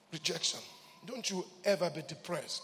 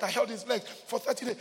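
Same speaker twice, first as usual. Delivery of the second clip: usual speaking voice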